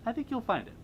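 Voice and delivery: lilting up high; high-pitched reassurance. Real, not acted. Host encouraging a fan to regain their spark, high-pitched. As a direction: repeat it in a high little voice. Same reassurance repeated much higher.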